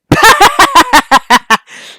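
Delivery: huge, high, loud monstrous laugh; booming creature laugh. Acted. Behemoth laughing at them, booming loud laugh. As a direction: let a giant laugh roar out.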